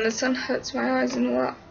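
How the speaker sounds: ordinary talk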